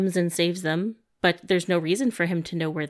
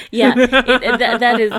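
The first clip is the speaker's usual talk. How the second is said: loud laughing agreement; 4 semitones higher, 9 dB louder than usual. Real, not acted. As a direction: laugh through the words.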